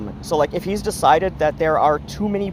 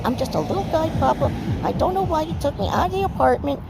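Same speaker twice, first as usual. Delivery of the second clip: much higher, squeaky little-guy cat voice; Bran's cat voice; 12 semitones higher than usual. Acted. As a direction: speak as a cocky little cat scheming about Phoebe. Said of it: "Bran's just like this".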